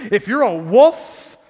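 "wolf" shouted slowly, the whole phrase drawn out; loud slow warning. Acted. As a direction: bear down hard on "if you're a wolf". Preacher hits warning hard.

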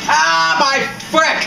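high, loud yelp of dismay; exasperated outcry. Real, not acted. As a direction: cry out as the game goes wrong. Outcry at mishap in game.

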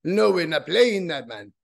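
badly faked Caribbean lilt; horrible Caribbean accent. Band's singer turning down the label, in a bad Caribbean accent. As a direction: refuse in a hammy, terrible Caribbean accent. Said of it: "That's my horrible Caribbean accent".

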